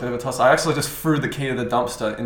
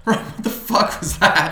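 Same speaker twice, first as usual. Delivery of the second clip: laughing disbelief; 7 semitones higher, 3 dB louder, slower than usual. Retold or imitated. Laughing to himself in car.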